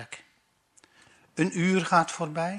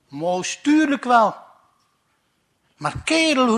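excited; 6 semitones higher, 7 dB louder than usual. Acted. Excited exchange.

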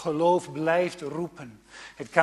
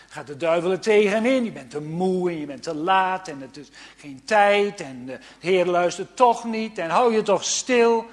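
loud, pushy tempter's voice; the devil talking you out of praying; devil's whispering voice; 4 semitones higher, 8 dB louder than usual. Acted. (luide, opdringerige stem van de duivel)

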